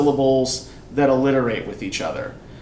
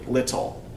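"Little" with LIT said more forcefully; stress on first syllable; 2 semitones lower, 5 dB quieter than usual. Shown as a demonstration. Teacher quizzing class on stress, "Little" stressed on LIT. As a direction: lean on the first syllable of "Little", let the second drop. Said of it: "Which syllable do I say more loudly?".

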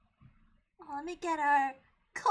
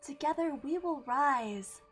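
sinister cult leader; 5 semitones lower, 5 dB quieter than usual. Pretend.

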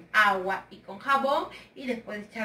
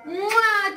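loud high smacking kiss; blown kiss; 7 semitones higher, 4 dB louder than usual. Real, not acted. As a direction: blow them a big loud kiss. Kiss to the children. (beso sonoro y agudo para los niños)

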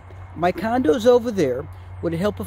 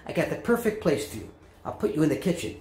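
normal talking voice

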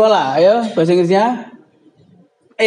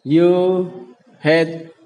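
ordinary delivery

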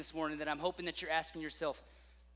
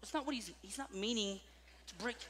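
hushed explaining; 3 semitones higher, 10 dB quieter than usual. Retold or imitated. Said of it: "I was like".

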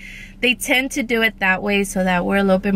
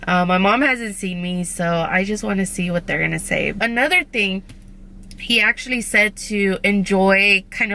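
metal braces on teeth; talking through fresh braces; with new braces. Real, not acted. Seen 3: Metal brackets visible on her teeth while talking throughout the clip.

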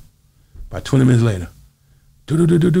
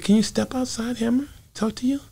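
imitating a soft, high whisper; 9 semitones higher, 8 dB quieter than usual. Mike quietly asks Hammer outside.

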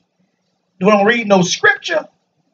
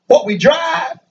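ordinary delivery

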